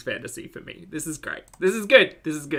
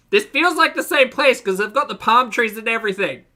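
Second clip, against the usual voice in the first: raised, higher excited voice; loud and excited; 8 semitones higher, 7 dB louder than usual. Real, not acted. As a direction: blurt it out loudly as recognition hits. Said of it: "Sorry if I'm being loud".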